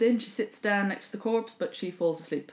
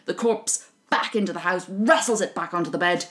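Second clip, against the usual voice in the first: ordinary delivery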